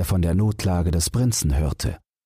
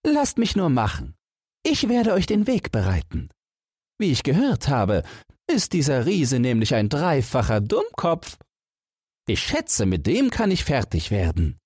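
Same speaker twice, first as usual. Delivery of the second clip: much higher, far-off sounding cheerful lad; Jack the giant killer; 9 semitones higher than usual. Acted. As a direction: play the confident young Jack, pitched way up. Jack speaks in story.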